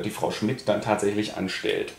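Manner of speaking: usual speaking voice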